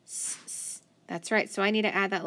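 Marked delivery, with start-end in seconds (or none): 0.0-0.8 "S, s" given as an unvoiced hissing s; whispered S sound. Shown as a demonstration. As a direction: say the S of "S, s" as a hissed, voiceless sound. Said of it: "C E make what sound".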